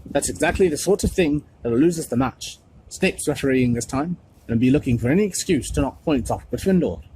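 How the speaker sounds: angry captain. A narrator's reading. Wood warning the team about Snape, loud and cross. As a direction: scold the team for fooling around. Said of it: "he yelled".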